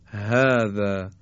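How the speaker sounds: هذا slow, with a light, open h and no throat tension; open breathy h. Shown as a demonstration. Teacher contrasting the light ه with the ح. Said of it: "گرفتگی در حلق ایجاد نمیشه".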